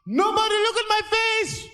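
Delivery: put-on high, shouted hijacker accent. Gunman standing up on the plane, high shouted orders. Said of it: "He said".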